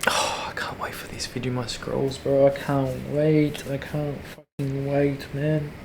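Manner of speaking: hushed, breathless whisper of craving; slow excited whisper; eager whisper. Real, not acted. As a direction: whisper it like you can barely contain yourself.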